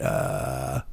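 low creaky vocal fry groan. Shown as a demonstration. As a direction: creak it out from the bottom. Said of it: "like that".

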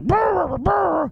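high, loud rolled brr of a startled dog; imitating dog growl-bark. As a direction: shout it like an alarmed dog. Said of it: "she's like".

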